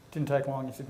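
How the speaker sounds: normal talking voice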